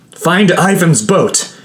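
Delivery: high, strained, halting cry; urgent shouting. A narrator's reading. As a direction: force out the instructions through pain, pitched high. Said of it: "I shouted".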